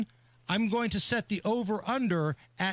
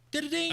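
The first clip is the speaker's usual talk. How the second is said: game sound imitation; 9 semitones higher, faster than usual. Imitating the mushroom hit sound.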